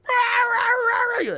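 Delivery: loud, very high yowling dog bark; mock husky yowl. Imitating the husky screaming at them in the dog run, loud yowl. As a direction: throw your head back and howl like a husky. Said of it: "typical husky shit".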